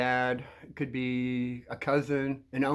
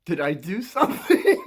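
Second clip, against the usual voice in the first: louder question dissolving into laughter; cracking up; 4 dB louder than usual. Real, not acted. Asking the kid what he did, cracking up. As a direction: lose the straight face on the last word. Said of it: "I'm just trying to keep a straight face".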